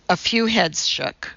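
usual speaking voice

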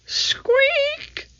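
very high, tiny mouse voice; mouse squeak. A narrator's reading. Little mouse appearing among the books, high squeak.

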